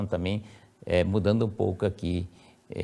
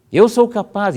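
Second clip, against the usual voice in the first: raised, emphatic affirmation; first-person affirmation; 10 semitones higher, 7 dB louder than usual. Shown as a demonstration. Teacher showing the first-person version, raised and firm. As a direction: Eu sou capaz said high and firm. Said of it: "em vez de ser".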